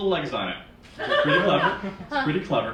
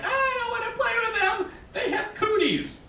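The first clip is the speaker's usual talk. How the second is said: put-on high, whiny little-kid voice; squeaky child refusing to play; four-year-old boy. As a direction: imitate a small child complaining. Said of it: "Sean came running over to us".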